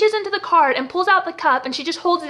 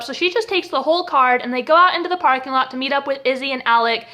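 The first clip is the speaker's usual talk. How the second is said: ordinary talk, nothing marked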